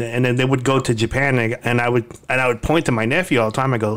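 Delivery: ordinary talk, nothing marked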